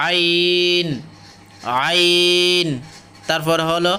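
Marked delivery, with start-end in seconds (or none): 0.1-0.9 আইন drawn out on a level tone; careful letter demonstration. Shown as a demonstration. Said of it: "আপনারা একটু খেয়াল করবেন". 1.9-2.7 আইন drawn out on a level tone; careful letter demonstration. Shown as a demonstration. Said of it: "আপনারা একটু খেয়াল করবেন".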